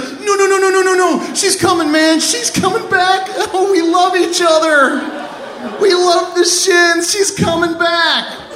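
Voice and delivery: high, panicked acted wailing; desperate pleading. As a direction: beg frantically, as if to stop a police call. Kid desperately reassures boss dialing police.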